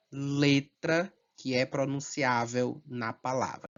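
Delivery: ordinary delivery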